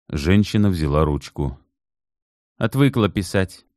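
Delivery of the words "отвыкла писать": complaining. Acted. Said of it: "пожаловалась она".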